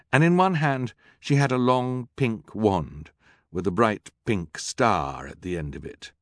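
usual speaking voice

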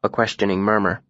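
ordinary delivery, nothing marked

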